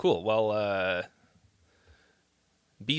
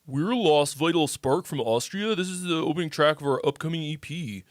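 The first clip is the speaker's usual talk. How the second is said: put-on Austrian accent; 2 semitones higher than usual. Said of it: "You like my Austrian accent?".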